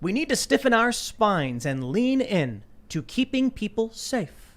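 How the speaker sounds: slow, solemn politician read; mock governor quoting, slow; reading a quote.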